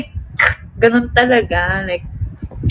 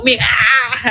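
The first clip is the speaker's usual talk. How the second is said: usual speaking voice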